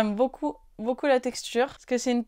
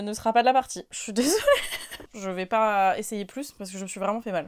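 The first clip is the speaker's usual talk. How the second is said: usual speaking voice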